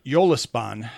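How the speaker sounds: Yolispan opening on a Y sound. Shown as a demonstration. Contrasting with Mexican Spanish, Yolispan said with a Y. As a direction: start Yolispan with a Y glide. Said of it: "J is making the Y sound".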